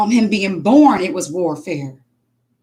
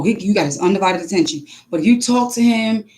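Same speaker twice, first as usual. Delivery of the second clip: ordinary talk, nothing marked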